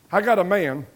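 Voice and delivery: normal talking voice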